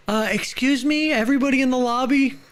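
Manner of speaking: very high, fast mock announcement; high announcer voice. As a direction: call out to the room in a squeak. Announcing to the lobby.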